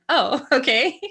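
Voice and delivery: high, loud startled reaction; loud, high-pitched taken-aback acceptance; retold shock. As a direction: re-enact being caught off guard, half amused. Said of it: "I was, like, shocked".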